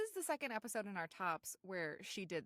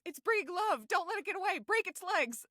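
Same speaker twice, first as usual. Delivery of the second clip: shouting; 10 semitones higher, 7 dB louder than usual. Retold or imitated. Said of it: "And he goes".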